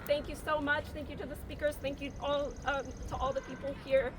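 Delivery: real emotion welling up; emotional thanks. Real, not acted. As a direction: thank them while fighting tears. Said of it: "Sorry, I'm getting emotional".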